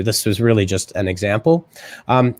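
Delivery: ordinary delivery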